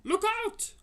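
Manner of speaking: high, sudden warning cry; frightened cry. Acted. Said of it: "cried the B F G".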